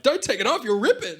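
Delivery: high, loud mock panic; hammed-up high shout; panicked. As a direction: blurt it up high in alarm. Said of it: "he's like".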